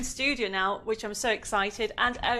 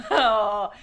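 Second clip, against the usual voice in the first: laughing; 2 semitones lower, 8 dB louder than usual. Real, not acted. Daughter brings odd mug.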